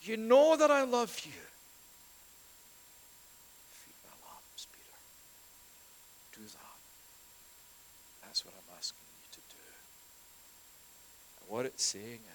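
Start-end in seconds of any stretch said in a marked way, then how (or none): 0.0-1.4 loud, high anguished plea; pleading protest. Retold or imitated. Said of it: "Peter, by this point, is just". 3.7-9.6 soft command. Retold or imitated. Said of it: "he asks him".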